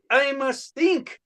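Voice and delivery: a sculptor musing to himself; Rodin pondering. Acted. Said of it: "my impression of ... Rodin having a creative block".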